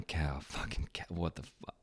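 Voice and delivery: muttering to himself. Retold or imitated. Walking down the stairs, muttering at himself after the slip. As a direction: grumble it under your breath.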